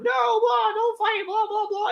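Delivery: mock squabble. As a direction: babble like a silly argument. Squabbling voices, retold.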